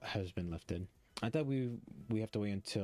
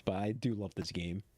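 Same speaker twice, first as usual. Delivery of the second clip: ordinary delivery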